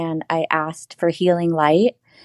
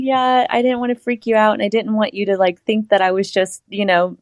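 sheepish explanation; 5 semitones higher than usual. Retold or imitated. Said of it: "I'm like".

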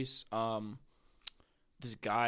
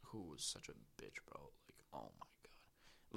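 exasperated whisper; 11 semitones lower, 18 dB quieter than usual. Real, not acted. Exasperated aside about a rude waitress.

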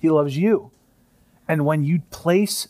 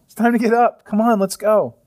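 cheerful wake-up; 6 semitones higher than usual. Retold or imitated. Jesus waking his child, bright and eager. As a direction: rouse a child with delight. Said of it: "He says".

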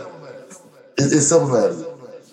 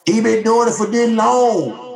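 mock fan excuse; 5 semitones higher, slower than usual. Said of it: "you come out with something like".

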